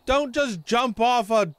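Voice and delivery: loud high exasperated yelling; angry yelling. Real, not acted. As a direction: shout at a reckless teammate. Teammate jumps off a tower.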